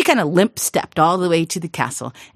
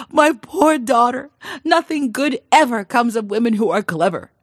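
lamenting father; 8 semitones higher, 6 dB louder than usual. Acted. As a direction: mourn her fate in a higher voice.

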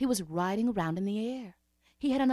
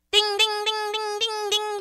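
high, sung bell chime; chiming ding; 13 semitones higher, 7 dB louder, slower than usual. Acted. The little man arrives with a high chime. Magic chime sound effect.

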